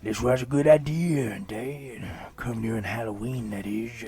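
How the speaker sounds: creepy put-on kid voice, loud start; sassy creepy child. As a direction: say it with conviction and exaggerated sass. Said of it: "He says this with the sass of Paris Hilton".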